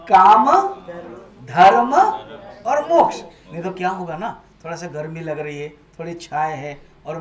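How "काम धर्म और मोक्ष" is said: each word called loud, rising on the last. Shown as a demonstration. Preacher loudly leads listeners through the four aims, word by word. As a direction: call out the words for the hall to echo. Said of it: "आप मेरे बाद थोड़ा बोलोगे".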